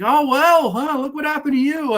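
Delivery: high, mocking put-on voice; smug Telly voice. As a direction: gloat over the fallen man. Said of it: "it's like".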